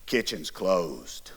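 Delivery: slow, low, breathless whisper from the dark; whispered threat. Retold or imitated. Dad catching a boy raiding the fridge at night, slow low whisper. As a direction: hiss it low like a looming dad.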